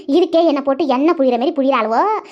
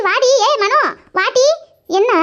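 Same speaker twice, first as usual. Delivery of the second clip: calling out; 9 semitones higher, slower than usual. Acted. Calling Mano over on arrival.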